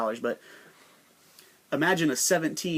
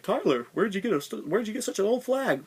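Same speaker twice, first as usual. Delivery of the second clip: curious viewer; 7 semitones higher than usual. Retold or imitated. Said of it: "you're like".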